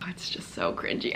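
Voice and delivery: a hushed, disgusted whisper; whispered aside. Real, not acted. To viewers about the cringe audio, whispering. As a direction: whisper it under the breath.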